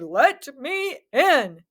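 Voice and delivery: slow, drawn-out wolf demand; lightly voiced wolf, very slow; wolf asks the pig to open up, slowly; wolf growl. A narrator's reading. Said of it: "he growled".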